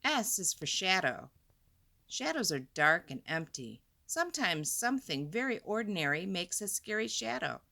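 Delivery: ordinary delivery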